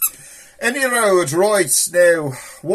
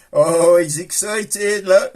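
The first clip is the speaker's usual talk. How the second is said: mock excited; 4 dB louder, slower than usual. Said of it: "You excited?".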